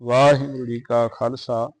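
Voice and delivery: measured, chanted Gurbani reading; steady devotional recitation; scripture recitation. Acted.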